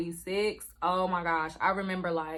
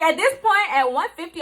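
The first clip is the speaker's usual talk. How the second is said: pitched way up, much louder; panicked, voice leaping up; exclaiming in a high rush; rising panic; 9 semitones higher, 8 dB louder than usual. Real, not acted.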